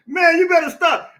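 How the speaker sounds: laughing through the words; real laughter, teasing the chat. Real, not acted. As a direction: crack up while telling them to stop.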